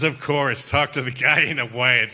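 mock-exasperated repeat of an order; exasperated echo. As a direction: throw the order back in disbelief.

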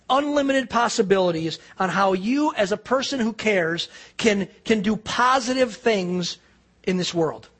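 ordinary delivery

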